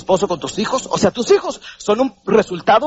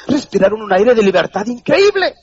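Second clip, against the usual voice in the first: ordinary talk, nothing marked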